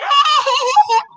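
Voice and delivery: cackling at her own pettiness. Real, not acted. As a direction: burst out in a high shriek of laughter. Big laugh mid story.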